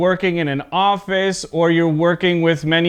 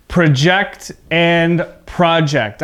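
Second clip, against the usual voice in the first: slow contrast, verb project then noun project; contrasting stress; slower than usual. Shown as a demonstration. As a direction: project said slowly as pruh-JECT, then project as PRAH-ject. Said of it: "more detail about the pronunciation".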